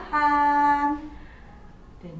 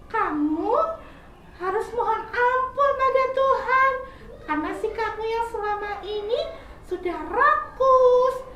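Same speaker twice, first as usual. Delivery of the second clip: much higher, louder, patient advising little squirrel; wise squirrel; 9 semitones higher, 3 dB louder than usual. Acted. Squirrel advising caterpillar to fast and repent, high kind voice. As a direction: counsel a child kindly.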